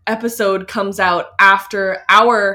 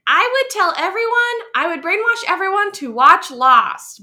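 much higher, eager gushing pitch; excited; 9 semitones higher than usual. Retold or imitated. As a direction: gush it like a superfan. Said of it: "I'd be like".

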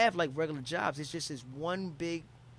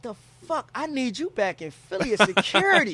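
loud, high hyped-up disbelief; amazed exclamation; 6 semitones higher, 10 dB louder than usual. Retold or imitated. Visitor wants his fighter friend as security back home, amazed exclamation. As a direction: exclaim it like an awestruck recruiter.

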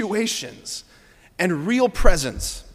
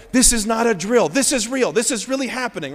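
high, urgent mock alarm; frantic excitement; 5 semitones higher than usual. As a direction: announce it like a fire drill gone real. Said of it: "This is John losing his mind".

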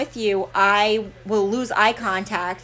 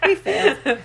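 laughing it out, pitched up; 10 semitones higher, 4 dB louder than usual. Real, not acted. Cohost jokes about failing, laughing along. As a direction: giggle through the admission.

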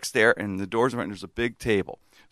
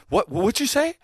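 high, stammered nervous question; retold nervousness; 10 semitones higher than usual. Manager just fired by his boss, nervous retold question. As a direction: imitate someone nervously checking what they heard. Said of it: "Like, nervous".

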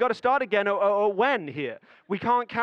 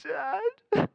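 put-on high, crying little-boy whimper; high whimpering kid voice; imitating a sobbing child; 13 semitones higher than usual. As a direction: mimic a child too upset to talk.

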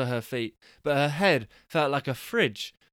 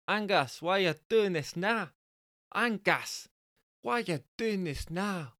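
high Bristolian woman's voice, practice take; attempted Bristolian woman; 8 semitones higher than usual. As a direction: have a go at a Bristolian wife. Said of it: "difficult for me to do a Bristolian women's accent".

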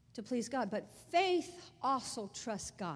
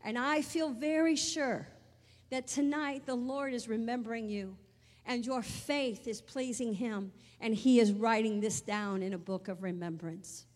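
fervent declaration; 5 dB louder than usual. Retold or imitated. Daughter prophesying comfort to her mother, loud and fervent. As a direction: proclaim it with conviction. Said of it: "I said".

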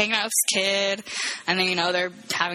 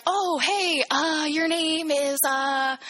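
high, drawn-out stalling; mock a man fishing for a name; imitated awkward forgetting; 8 semitones higher, much slower than usual. Man greeting last night's date, mock awkward stalling.

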